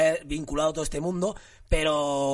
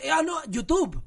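higher-pitched mock misunderstanding; imitated mishearing Spaniard; 10 semitones higher, slower than usual. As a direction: mimic someone who mishears a name, pitched up. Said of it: "y me dices".

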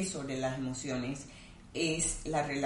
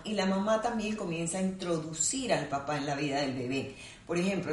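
normal talking voice

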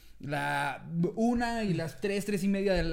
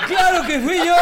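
much louder and higher, laughing as he owns it; shameless admission; 10 semitones higher, 11 dB louder than usual. Retold or imitated. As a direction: proudly own up with a laugh. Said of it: "bien cínico le digo".

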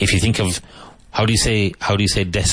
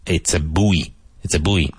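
American "buoy" said as "boo-ee"; "buoy" in American English, two syllables "boo-ee". Shown as a demonstration. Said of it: "For an American".

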